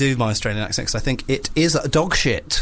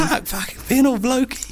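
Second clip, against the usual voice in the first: mock Aussie; 11 semitones higher than usual. Mocking Australian backpackers.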